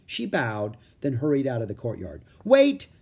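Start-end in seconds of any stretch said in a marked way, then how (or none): 2.4-2.9 high, loud call after someone. A narrator's reading. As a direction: shout to stop her leaving. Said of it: "said Annie".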